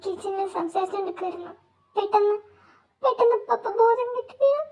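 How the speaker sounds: soft, halting acted crying; tearful. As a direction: choke out bad news through tears. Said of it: "നീ എന്തിനാ കരയുന്നേ".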